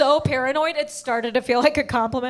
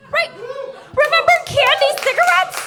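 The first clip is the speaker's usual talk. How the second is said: excited nostalgia; 13 semitones higher than usual. Real, not acted. Nostalgic excited question to audience.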